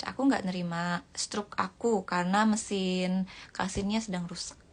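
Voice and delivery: ordinary delivery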